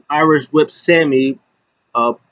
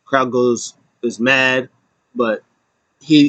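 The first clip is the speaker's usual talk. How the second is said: normal talking voice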